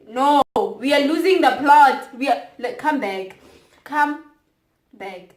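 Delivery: loud, urgent rallying call; rally them back; loud plea. Real, not acted.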